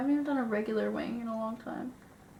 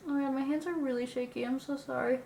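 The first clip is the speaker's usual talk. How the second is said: normal talking voice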